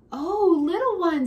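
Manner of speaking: a touch of a soothing mother; mom's voice. Acted. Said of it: "says my mom".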